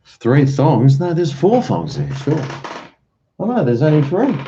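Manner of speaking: high, loud puzzled recount; suddenly alarmed at the missing thong; real surprise, voice jumping high; surprised. Real, not acted.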